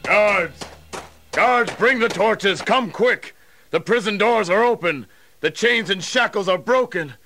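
high, urgent calls for the guards; alarmed commanding shout. Acted. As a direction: call out the emergency at full voice. Jailer raises the alarm.

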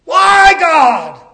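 high, drawn-out shouted plea; loud re-enacted cry of desperation; anguished cry to God. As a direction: wail it upward at full volume, then let it fall.

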